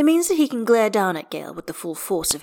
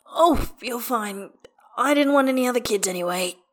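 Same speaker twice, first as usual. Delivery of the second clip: higher, winded, pained reply; wheezing; 6 semitones higher than usual. A narrator's reading. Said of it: "Gale wheezes".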